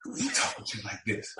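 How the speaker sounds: whispered aside to himself; quiet stunned mutter; hushed inner thought. Retold or imitated. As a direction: breathe it out in disbelief. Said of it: "And I'm like".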